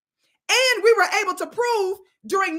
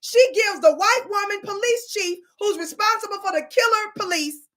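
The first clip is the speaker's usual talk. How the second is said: ordinary talk, nothing marked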